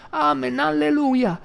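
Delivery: put-on very high, loud, slow old woman's shout; frenzied high praise; ecstatic cry. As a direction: wail it out in fervor.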